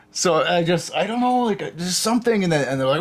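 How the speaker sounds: usual speaking voice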